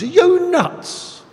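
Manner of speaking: very high, slow mock disbelief; imitating his wife's amazed squeal; incredulous wife. Wife calling out to him gardening in rain, mock incredulous. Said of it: "She goes".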